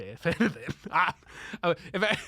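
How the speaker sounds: ordinary talk, nothing marked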